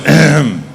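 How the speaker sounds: preparatory throat clear. Acted. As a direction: clear your throat before a grand command. Friend preparing to command the car.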